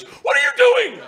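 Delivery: high, loud outraged yell; mock furious trainer shout; losing his shit. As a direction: explode at someone, voice shooting up. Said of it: "the trainer loses his shit".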